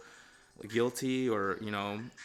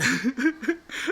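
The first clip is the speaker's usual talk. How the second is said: loud laugh; 14 semitones higher, 10 dB louder than usual. Real, not acted. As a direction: burst of high, loud laughter. Laughs at client's insult.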